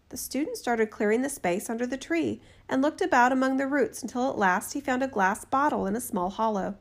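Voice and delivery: ordinary delivery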